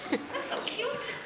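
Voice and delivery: high, slow coo with a laugh; slow sing-song coo laughing. Real, not acted. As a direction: coo and giggle. Cooing with laugh to child.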